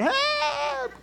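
high, loud mock cry of Help; yelling for help. Preacher mimics his wife shouting help in her car, high and loud. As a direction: shriek Help like the lady in the car. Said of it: "this lady going".

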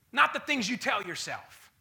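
ordinary delivery

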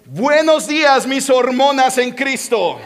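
high, loud greeting to a congregation; proud public greeting. Retold or imitated. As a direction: boom out the botched greeting confidently from the stage. Acted botched greeting from the stage.

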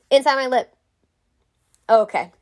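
usual speaking voice

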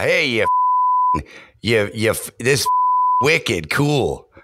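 half-hearted Boston accent, drop the R's. Shown as a demonstration. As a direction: do a quick, unwarmed Boston accent demo. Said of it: "You gotta kinda talk like this".

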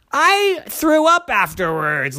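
high, slow emphatic protest; indignant dad. A narrator's reading. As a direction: draw it out in comic indignation. Daddy objects.

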